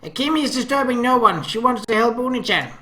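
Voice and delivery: put-on high, loud childish whine; protesting little sister. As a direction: insist like a pouting small girl. Akemi protesting in third person.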